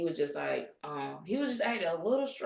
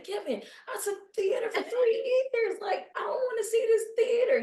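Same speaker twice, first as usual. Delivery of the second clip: exasperated refusal. Retold or imitated. Quoting herself refusing Kevin.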